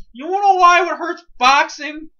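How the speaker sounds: loud, high rant opener; fired-up ranting start; ranting outburst. Real, not acted. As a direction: launch into the rant with fire.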